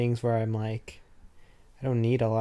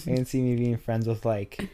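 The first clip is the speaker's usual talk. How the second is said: ordinary delivery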